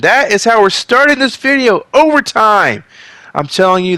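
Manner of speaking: excited yelling to the audience; yelling at viewers. Real, not acted. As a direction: shout the intro at the camera. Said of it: "I don't know why I'm yelling at you".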